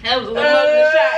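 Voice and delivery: talking through a mouthful of drink; words round a big gulp; mouth full. Real, not acted. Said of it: "My mouth was full".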